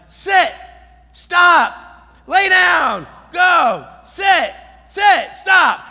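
very high, loud single-word orders; shouted commands. Retold or imitated. Owner ordering his loose dog, shouting. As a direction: holler helplessly at a disobedient dog.

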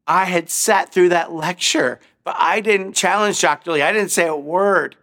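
a man ashamed of his silence; anguished. Retold or imitated. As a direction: Richard recalls failing to speak, anguished. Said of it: "Richard Harvey says".

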